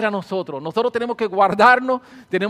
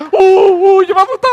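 a much higher, louder startled uh uh; mock fright; 11 semitones higher, 13 dB louder than usual. Recalling how the devil scared her, mock fright. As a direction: ham up the old scared self.

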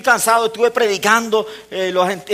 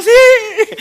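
tearful yes; 13 semitones higher, 9 dB louder than usual. Retold or imitated. Mother answers through tears.

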